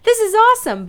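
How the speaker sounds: high, thrilled burst of joy; excited. Retold or imitated. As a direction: cheer it out, delighted. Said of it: "I was kinda like".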